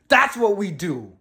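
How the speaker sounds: ordinary talk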